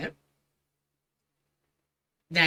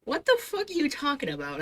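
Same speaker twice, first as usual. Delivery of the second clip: incredulous; 9 semitones higher, 5 dB quieter than usual. Real, not acted. Repeated in disbelief.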